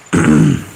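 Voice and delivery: short rough throat clear. Real, not acted. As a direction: clear your throat before talking. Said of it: "I try not to cough too much".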